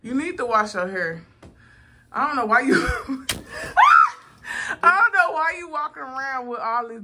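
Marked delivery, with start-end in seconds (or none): 2.8-4.1 loud high cackle; shrieking laugh. Real, not acted.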